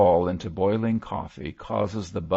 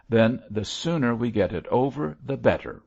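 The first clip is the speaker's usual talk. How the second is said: peevish, higher-pitched retort; petulant; 6 semitones higher, 4 dB louder than usual. A narrator's reading. Wife giving in on remarriage, petulant. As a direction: say it in a huff. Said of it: "replied Missus Pendle petulantly".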